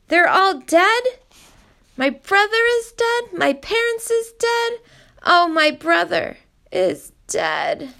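put-on boy mourning his family in a high lament; wailing grief. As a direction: wail out the loss, breaking down. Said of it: "he wailed".

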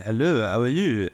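put-on English with a French accent. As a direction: imitate a French speaker's English. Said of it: "a person from France is like".

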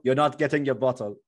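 lightly put-on Jamaican accent; a hint of a Jamaican bouncer refusing. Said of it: "what accent is that supposed to be".

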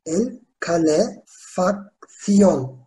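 "El calefacción" very slowly, with pauses between syllables. Shown as a demonstration. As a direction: dictate "El calefacción" piece by piece. Teacher dictates the word by syllables. ("El calefacción" sözünü ağır ağır hecele)

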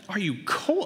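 high-pitched mocking repeat; incredulous echo. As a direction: throw the question back in disbelief. Dad mocking wife's question.

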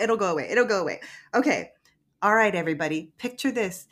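usual speaking voice

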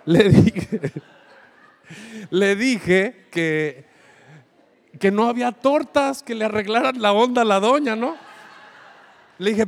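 can't hold back the laughter; laughing. Real, not acted. Preacher laughs while retelling his reply to the customer, laughing.

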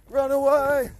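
normal talking voice

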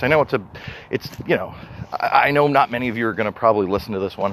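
usual speaking voice

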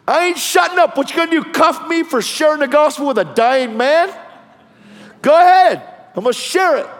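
high, loud defiant retort; defiant preaching. Retold or imitated. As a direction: dare the cop at full voice. Defiant retort to cop in sermon.

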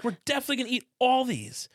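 high-pitched mock enthusiasm; falsely eager sarcasm; sarcastic. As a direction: pretend to be keen on the snacks. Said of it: "which was sarcastic".

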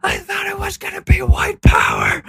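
high, loud sobbing yell; high loud hammed-up sob-shout; mock wailing shout. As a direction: wail it out, hammed-up despair. Crying driver impression continues.

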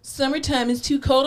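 high-pitched mock whining; mocking complainers. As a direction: mimic people griping about the cold. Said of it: "I hate when people are always like".

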